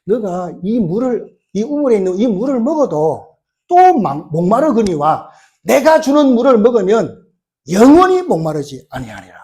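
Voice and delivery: lightly voiced, forceful declaration; Jesus. Jesus answering the woman at the well, lightly voiced declaration. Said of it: "그러면서 예수님께서요".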